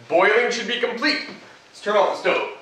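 normal talking voice

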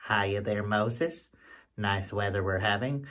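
low, soft easy hello; President Bush voice. Acted. Bush greets Moses in joke.

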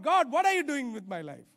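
half-shouted frustrated prayer; loud exasperated plea. Retold or imitated. Pastor recounting rejection cry to God.

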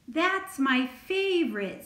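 perking up, high and loud; lifted, pleased exclamation; brightening. A narrator's reading. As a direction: brighten suddenly with delight.

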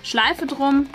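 usual speaking voice

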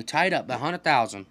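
normal talking voice

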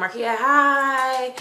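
high, loud hello; shout-out greeting. Real, not acted. Vlogger says hello to her friend, calling out. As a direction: call out a drawn greeting.

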